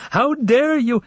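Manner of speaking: a hammed-up indignant snap; mock outburst. Secretary mimicking her boss's outburst, mock indignant. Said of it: "and he blew up".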